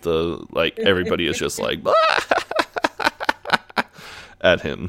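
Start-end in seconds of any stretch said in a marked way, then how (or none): 1.8-4.2 high, mocking burst of laughter; imitated crowd laughter. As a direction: imitate everyone cracking up at someone. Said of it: "we just hear all this laughing".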